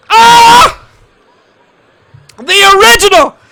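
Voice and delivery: screaming high and loud in pure joy; ecstatic screaming. Real, not acted. Fan losing it at the big return, screaming. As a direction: lose it completely as a fan.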